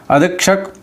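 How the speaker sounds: ordinary talk, nothing marked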